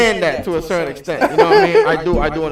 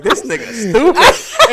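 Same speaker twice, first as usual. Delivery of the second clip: retold heckling; 6 semitones higher, 5 dB louder, slower than usual. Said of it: "Grisel's, like, in the background".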